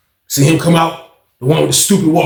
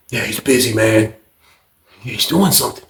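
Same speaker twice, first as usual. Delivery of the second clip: low, softer stoned reply; dazed cellmate; 7 semitones lower, 4 dB quieter than usual. Retold or imitated. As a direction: imitate a red-eyed cellmate, pitched down. Dazed red-eyed cellmate answers, retold.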